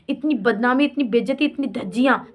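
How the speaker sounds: ordinary talk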